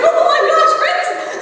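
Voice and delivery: high, loud, laughing alarm; alarmed yelp. Retold or imitated. Teacher retelling her panic at a sick child. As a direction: shriek the name in panicked laughter. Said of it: "I was like".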